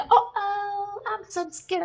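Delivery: high mocking uh-oh; mock-frightened sarcasm; sarcastic mock fear. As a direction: pretend to be scared, sarcastically.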